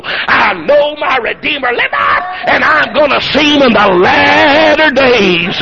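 slow, drawn-out victory shout; triumphant crowing. Acted. Job crowing over the beaten devil, triumphant preacher's shout. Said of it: "began to crow".

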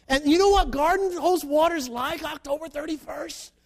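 ordinary talk

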